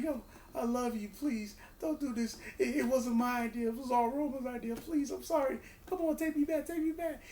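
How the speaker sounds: high, whiny begging voice; pleading Heyman. Retold or imitated. Heyman begs Brock not to hurt him, high whiny plea. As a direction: beg for mercy, pitch climbing as it goes. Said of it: "He's like".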